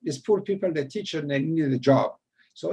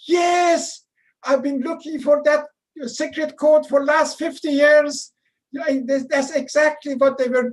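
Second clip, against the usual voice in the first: excited outburst; 10 semitones higher, 7 dB louder than usual. Retold or imitated. Buffman jumps from his chair.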